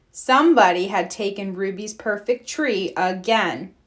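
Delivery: ordinary delivery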